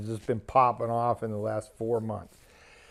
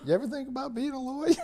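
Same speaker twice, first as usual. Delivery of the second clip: high, quick, soft aside from a cop; hushed, high mock-cop question; cop's voice; 11 semitones higher, 7 dB quieter, much faster than usual. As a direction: imitate a cop asking, pitched up and rapid. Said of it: "you hear the cop".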